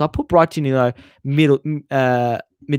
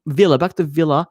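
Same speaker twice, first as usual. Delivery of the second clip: normal talking voice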